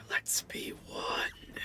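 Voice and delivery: slow, very soft eerie whisper; breathy creature whisper. Acted. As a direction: draw it out in a hushed, sighing whisper. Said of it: "let out, like, a".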